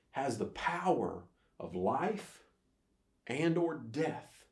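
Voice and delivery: drawn out, each word weighted; slow emphatic phrase. Acted. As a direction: pastor slowing to land the point to his church, slow and weighty. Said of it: "preacher slows for key phrase".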